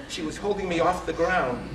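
usual speaking voice

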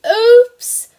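high, loud storybook oops; a big surprised oops for the kids; playful oops. Acted. Teacher reading to kindergarteners as Clifford spills flour, high loud oops.

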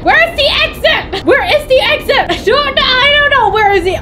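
very high frantic yelp; frantic, pitch shooting way up; panicked searching. Real, not acted. Hunting for exit while chased.

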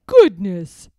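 loud burst of astonishment; surprised exclamation. A narrator's reading. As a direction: blurt it out, amazed. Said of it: "said the goblin".

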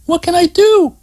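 high worried woman's voice asking for help; anxious Terry. Acted. Terry sees Scott trapped, asks anxiously in a high voice. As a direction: she forgets her anger and worries for him. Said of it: "glanced at him anxiously".

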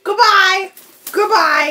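high, loud call to the bird; loud goodbye. Real, not acted. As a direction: shout goodbye at the bird playfully.

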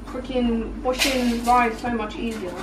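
ordinary talk, nothing marked

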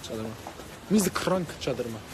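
normal talking voice

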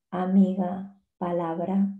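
usual speaking voice

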